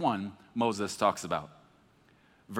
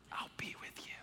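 breathy intimate whisper; 18 dB quieter than usual. Retold or imitated. God promising Moses presence, in a whisper. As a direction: breathe it like a secret.

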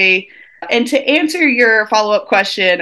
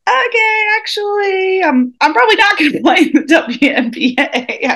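high, sheepish realization; high school self giving up the WNBA dream, sheepish; realizing teen self; 12 semitones higher than usual. Retold or imitated. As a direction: admit it with a laugh. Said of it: "it's like".

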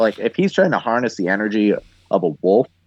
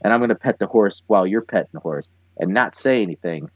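fast, lowered nervous self-talk; rushed inner monologue; 4 semitones lower, faster than usual. Retold or imitated. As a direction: rattle off the plan under your breath. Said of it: "And he's like".

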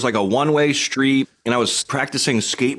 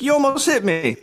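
much higher indignant cry; indignant mock yell. Skateboarder retells yelling at the driver, high indignant cry. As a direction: protest at the driver, pitched way up. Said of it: "I was like".

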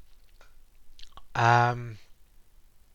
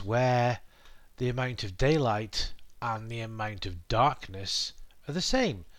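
usual speaking voice

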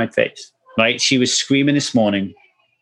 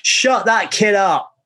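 slow, higher, fed-up order; drunk dad snapping; 7 semitones higher, slower than usual. Retold or imitated. Father mimicking his old drinking self ordering the kid silenced, slow and irritated. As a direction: bark it like a worn-out drinking father. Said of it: "I'm just saying".

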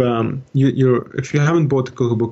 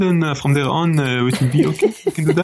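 French accent. Shown as a demonstration. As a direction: put on a French accent for show. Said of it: "I'll do the French one".

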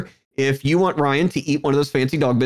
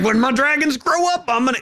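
higher, gruff cartoon cowboy; Yosemite Sam voice; 7 semitones higher than usual. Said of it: "this is Yosemite Sam as Daenerys Targaryen".

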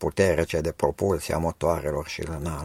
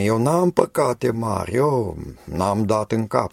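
higher, louder, proud protest of innocence; smug self-justifying; 5 semitones higher, 6 dB louder than usual. Retold or imitated. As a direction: boast that your sins are nothing. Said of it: "Sau altul spune".

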